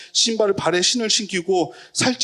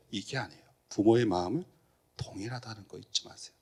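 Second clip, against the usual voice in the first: whisper; 7 semitones lower, 8 dB quieter than usual. Real, not acted. Intimate reminder to congregation.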